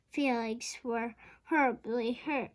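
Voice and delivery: usual speaking voice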